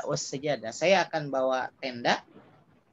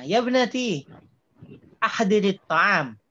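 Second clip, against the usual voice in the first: higher, louder call to a daughter, slow at the end; 5 semitones higher, 3 dB louder, slower than usual. Retold or imitated. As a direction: call out to your daughter to bring the food. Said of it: "Misalnya dia ngomong ke anaknya".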